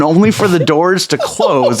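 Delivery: ordinary talk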